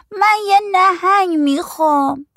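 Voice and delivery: put-on very high, drawn-out little kid; small child's voice. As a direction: stretch it out in a high kiddie voice. Narrator voices small child Azin.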